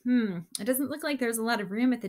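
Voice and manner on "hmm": usual speaking voice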